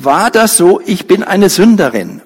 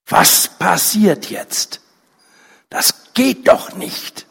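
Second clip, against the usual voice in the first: tense whisper; 3 semitones higher, 5 dB quieter, much slower than usual. A narrator's reading.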